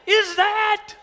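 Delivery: very high, squeaky sweet voice; a sugary voice that isn't his own; surprised sweet voice. Retold or imitated. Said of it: "Who the heck is this talking".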